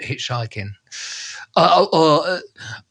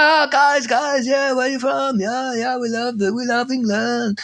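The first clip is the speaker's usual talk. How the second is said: higher, chummy imitation of the driver; a hint of a jolly foreign stranger, pitched up; eager foreign driver; 10 semitones higher than usual. Driver greeting English hitchhikers, higher chummy mock voice. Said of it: "he's like".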